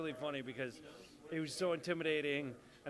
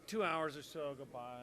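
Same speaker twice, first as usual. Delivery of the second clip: usual speaking voice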